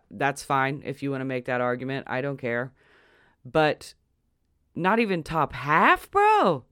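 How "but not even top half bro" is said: higher and louder, "bro?" shooting up high; outraged rhetorical question, fed up; mad disbelief. Real, not acted. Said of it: "Do you understand why I'm mad?".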